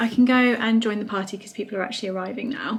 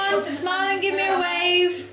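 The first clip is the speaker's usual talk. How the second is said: calling out; 8 semitones higher than usual. Real, not acted. Filming guests, calling to them.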